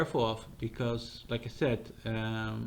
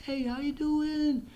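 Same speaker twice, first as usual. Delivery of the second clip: much higher and slow, stretched with cheer; bright AI greeting; 12 semitones higher, slower than usual. Shown as a demonstration. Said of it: "It's gonna, gonna go like".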